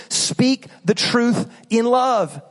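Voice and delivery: "Speak the truth in love" said very slowly; slow emphatic verse. Shown as a demonstration. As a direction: weigh each word of the verse. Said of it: "as Paul said".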